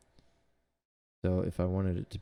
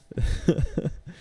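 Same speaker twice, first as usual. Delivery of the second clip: laughing; 8 semitones higher, 5 dB louder than usual. Real, not acted. Laughing after the shaking.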